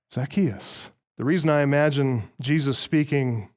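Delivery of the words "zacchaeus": soft, low, friendly call by name; tender, familiar. Acted. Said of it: "with a tender and familiar voice".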